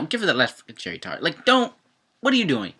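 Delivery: ordinary delivery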